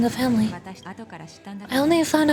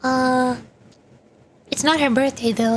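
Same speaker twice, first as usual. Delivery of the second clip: ordinary delivery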